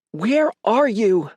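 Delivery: high, drawn-out panicked demand. A narrator's reading. Mother demanding where her son is, panicked. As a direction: stretch each word in rising alarm. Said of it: "voice panicked".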